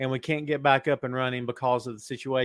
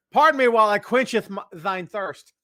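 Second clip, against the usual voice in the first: hammed-up old-English god voice, higher and louder; mock Thor; 6 semitones higher, 7 dB louder than usual. Host plays Thor for the viewers with a hint of mock grandeur. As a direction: grandly ham up a thirsty Norse god. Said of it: "Can you tell Thor's coming out in two weeks?".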